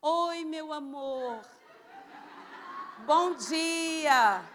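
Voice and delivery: imitating a sugary sweet friend, vowels stretched out; meiga e delicada. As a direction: gush a drawn-out, syrupy greeting in mock sweetness. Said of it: "essa pessoa calma, meiga e delicada". (imitando voz meiga e melosa)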